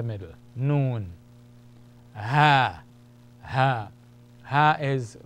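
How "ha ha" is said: Ha breathed from the lowest part of the throat; Arabic letter sound demo; deep-throat ha. Shown as a demonstration. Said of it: "in the deeper throat".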